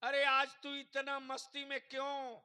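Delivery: high, loud call to the Kaaba; impassioned preaching. Acted. Acts out addressing the Kaaba in preaching. (जोशीली तक़रीर में ऊँची पुकार)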